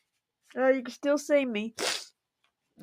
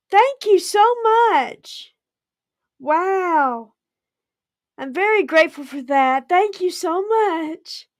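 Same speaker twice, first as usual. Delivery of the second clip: overwhelmed thanks; 6 semitones higher, 6 dB louder than usual. Real, not acted. Overwhelmed by a big donation.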